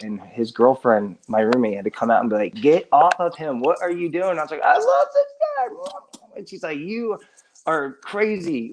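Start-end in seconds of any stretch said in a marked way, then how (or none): 2.5-4.4 retold scolding. Roommate pulling drunk friend off boyfriend, mock scolding. 4.6-6.4 very high, drawn-out mock drunken gushing; toasty drunk. Drunk friend clinging to roommate's boyfriend, mock gushing. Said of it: "I got pretty toasty". 6.8-8.7 higher, drawn-out mock disbelief; retold exasperation. Roommate to her drunk friend, mock exasperation.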